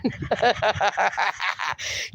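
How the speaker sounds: soft run of giggles; real giggling. Real, not acted. Host laughing along with Mark and Simple, giggling. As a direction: giggle softly along with them.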